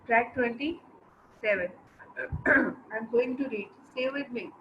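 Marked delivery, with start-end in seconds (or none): none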